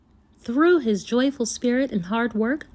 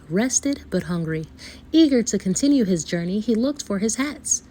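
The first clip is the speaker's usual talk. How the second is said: ordinary delivery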